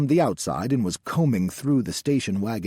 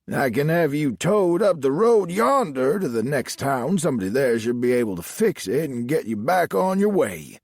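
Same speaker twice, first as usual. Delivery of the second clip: higher country lawman voice; Southern sheriff; 5 semitones higher, 4 dB louder than usual. A narrator's reading. Sheriff offering the stranded driver a tow, Southern sheriff voice.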